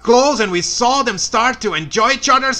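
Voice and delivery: loud, high, exasperated; exasperated loud rant. Real, not acted. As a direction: rant it like you're fed up. Sarcastic rant at the film.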